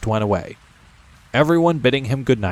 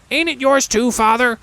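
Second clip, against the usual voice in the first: put-on young goblin son; 14 semitones higher than usual. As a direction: whine a little about the heavy chest.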